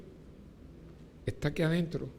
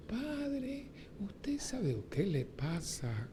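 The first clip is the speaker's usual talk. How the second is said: high, soft, then a slow whisper; mocking breathy churchgoer; 5 semitones higher, 11 dB quieter, much slower than usual. Priest mocking a churchwoman's strange hushed way of talking. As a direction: imitate a parishioner's affected hush. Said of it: "La gente no habla así".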